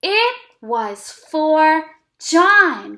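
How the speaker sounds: slow, word by word reading; teacher models a sentence for kids to repeat, slowly. Shown as a demonstration. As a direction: read it slowly so they can copy. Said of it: "Now read it with me".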